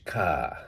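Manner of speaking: "car" as "kah", ending in AH; dropped R. Shown as a demonstration. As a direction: let "car" fall away on an open vowel without R. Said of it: "it becomes like an A H".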